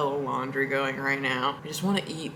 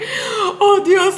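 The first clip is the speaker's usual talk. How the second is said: a high, loud "Oh, Dios" cracking with laughter; hammed-up cry, half laughing; laughing exclamation; 9 dB louder than usual. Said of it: "she was like".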